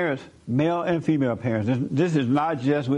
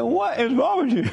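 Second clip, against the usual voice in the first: exasperated; 9 semitones higher than usual. Retold or imitated. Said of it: "I was like".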